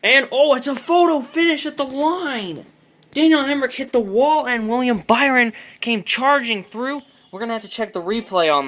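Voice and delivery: excited, high, loud finish call; race caller. Acted. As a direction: yell the photo finish live. Photo finish live call.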